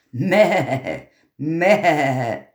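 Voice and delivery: bleating meh meh; goat bleat. Acted. The goat bleats to the tailor, a mock goat bleat.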